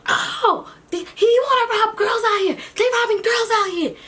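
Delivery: high, loud crying shout; shouting for help. Retold or imitated. Cantu yelling to the boys in the park, high crying shout. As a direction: yell it out across the park. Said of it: "she starts shouting like".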